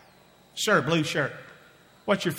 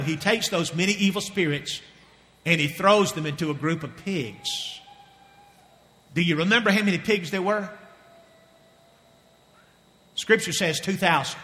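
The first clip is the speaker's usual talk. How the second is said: normal talking voice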